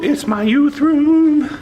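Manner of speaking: high, loud and slow, sing-song; excited. Real, not acted. Minister shows off his youth room, high excited sing-song.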